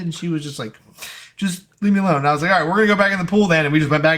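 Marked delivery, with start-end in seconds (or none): 1.4-2.2 higher, louder tearful plea; upset sister voice. Retold or imitated. Sister crying over [name], higher upset plea. 2.5-3.7 higher, louder, quick brush-off; dismissive kid. Retold or imitated. Brushing off crying sister, loud quick dismissal.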